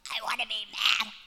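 strained, voiceless mock tantrum; harsh whispered protest; mad child. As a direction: imitate a kid growling in defiance. Replays son's angry choice.

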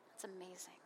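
breathy whispered aside. Real, not acted. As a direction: whisper it to yourself, amused. Amused aside after joke.

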